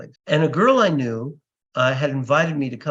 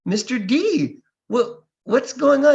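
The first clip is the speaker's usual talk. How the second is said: mock surprise; 8 semitones higher, 5 dB louder than usual. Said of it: "I go".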